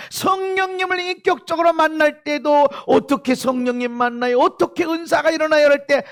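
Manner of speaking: loud, high-pitched preaching; forceful preaching. Real, not acted.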